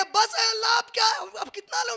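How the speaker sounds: high, loud cry of enough; overwhelmed shout. Pretend. People overwhelmed by God's giving.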